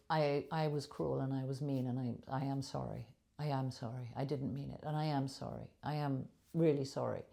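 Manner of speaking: subdued, earnest remorse; sincere apology. Shown as a demonstration. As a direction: apologise quietly, with genuine feeling. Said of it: "I can say it with genuine feeling".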